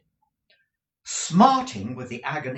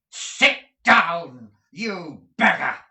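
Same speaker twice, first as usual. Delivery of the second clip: high, loud furious scream; screaming rage; 7 semitones higher, 8 dB louder, slower than usual. Acted. Squeers, beside himself with rage, screams at Nicholas. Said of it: "screamed Squeers".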